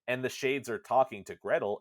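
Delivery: normal talking voice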